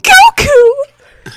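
high ringing cry of the name. Acted. Anne crying out to her rescuer, high and loud. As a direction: call out his name, high and desperate. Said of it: "cried Anne".

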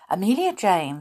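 plain reading voice

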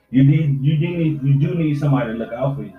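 ordinary talk

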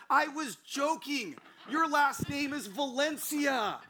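high, loud mock outrage; exasperated scolding. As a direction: holler it like an appalled friend telling someone off. Acts friend's exasperated scolding.

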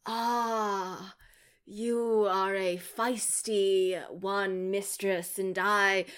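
slow, lightly voiced sleazy charmer; mock incel villain. Mocking a movie villain, slow smarmy flirt. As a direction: drawl it out like a sleazy online creep. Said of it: "they talk like an incel on the internet".

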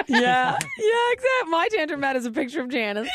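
laughing. Real, not acted. Joking, laughing.

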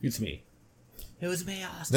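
tipsy, hiccupping through the words. Real, not acted. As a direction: hiccup while owning up. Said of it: "somebody got the drunk hiccups".

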